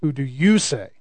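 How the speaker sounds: "you" stressed in a low, soft question; single out "you"; the preacher repeating Jesus's question. Shown as a demonstration. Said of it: "the emphasis in this second question".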